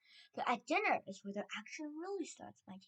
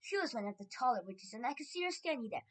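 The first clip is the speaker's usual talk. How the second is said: ordinary delivery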